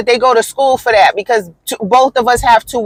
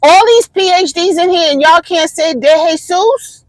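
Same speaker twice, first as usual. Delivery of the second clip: exasperated high call-out; 8 semitones higher than usual. Retold or imitated. Calling out colleagues who can't say a name, high and incredulous. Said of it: "And I said".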